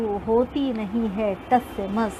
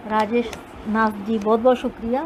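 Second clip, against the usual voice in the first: ordinary talk, nothing marked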